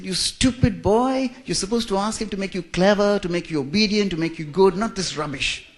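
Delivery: mock the aunt's indignant scolding; upset aunt scolding. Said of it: "She was so upset".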